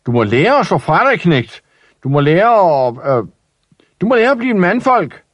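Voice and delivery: fast high lecturing shout, building; blustering father. Acted. Father shouting at Ivan to become a man, building shout.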